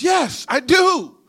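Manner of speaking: high, drawn-out shout; loud eager reply. Acted. Barefoot man answering the offer of shoes, high loud call. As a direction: shout it back slowly and high.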